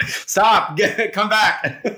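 high laughing protest; giggling, come back; laughing plea. Real, not acted. Video cut out, laughing plea.

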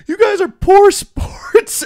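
very high, loud, drawn-out mock complaint; slow, high-pitched caricature of indignation; mocking loser's whine. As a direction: imitate a sore loser squealing in protest. Beaten guy voiced protesting.

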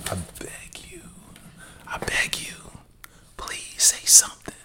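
hushed unvoiced plea; whispering. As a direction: whisper it like a secret dare. Mock pleading sign-off.

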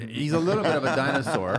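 laughing growly dinosaur noise through the words; dinosaur impression. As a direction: ham it up like a plant eating dinosaur. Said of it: "the best dinosaur impression ever".

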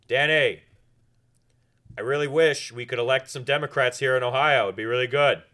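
loud, earnest booming plea; big friendly voice; Tim Misney voice. Retold or imitated. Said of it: "I used to be able to do his voice".

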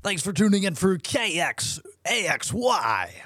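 slow, high radio announcer. Acted. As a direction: boom it out like a DJ. Host mimics radio DJ sign-on.

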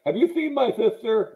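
Linus voice. Retold or imitated. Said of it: "Linus will be all like".

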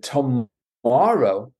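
drawn-out slow disbelieving tomorrow; shocked disbelief. Retold or imitated. Hearing the agency says tomorrow, slow stunned echo. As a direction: stretch it out, can't believe it.

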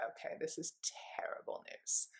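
hushed whisper of dread. Retold or imitated. Recalling the doctor's call, a frightened whisper. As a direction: breathe it out, fearing the worst.

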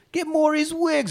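high, held-up sales pitch; cheesy commercial pitchman. Retold or imitated. Wig seller in his shitty commercial, high cheesy pitch. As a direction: pitch it like a bad TV ad. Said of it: "we get to see a shitty commercial".

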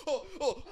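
high imitated "oh, oh"; mock victim gasps. Imagining someone hit by acid, mock high gasps. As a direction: gasp high through a laugh. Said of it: "they're like".

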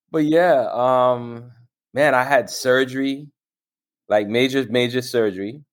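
ordinary talk

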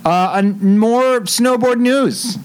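upbeat high news read; newscaster voice. Pretend. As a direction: announce it like a sports anchor.

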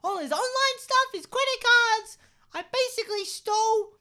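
very high, loud puppet voice; squeaky little red monster; Elmo voice. Acted. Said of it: "we just had Elmo".

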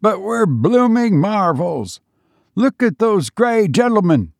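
high excited shouting in a Cockney soldier's manner; can't believe his eyes, voice up high; thrilled Tommy shouting at the falling enemy; amazed soldier. A narrator's reading.

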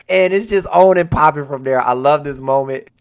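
usual speaking voice

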